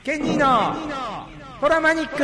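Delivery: radio host calling the corner name; corner title call. Acted. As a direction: announce the segment title like a jingle.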